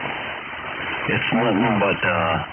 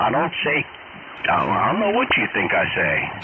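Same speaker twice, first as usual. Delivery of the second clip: normal talking voice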